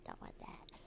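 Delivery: whispered Don't want that. Real, not acted. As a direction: breathe Don't want that voicelessly. Repeated, second take whispered.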